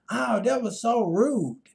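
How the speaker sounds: hammed-up prissy gasp of offense; offended woman. Mocking the woman from the online chat as offended. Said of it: "And she like".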